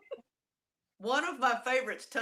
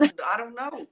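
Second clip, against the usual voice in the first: normal talking voice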